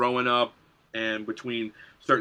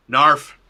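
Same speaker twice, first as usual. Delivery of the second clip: loud, goofy yelped narf; cartoonish narf shout; signature narf; 3 semitones higher, 13 dB louder than usual. Shown as a demonstration. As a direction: blurt the catchphrase out loud and silly. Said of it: "everyone's gotta know that I say this too".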